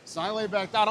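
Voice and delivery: ordinary delivery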